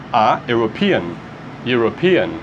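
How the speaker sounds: European started with a Y sound; English pronunciation. Shown as a demonstration. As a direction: glide into European with Y. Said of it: "Esse som aqui é European". (European pronunciado com Y no começo)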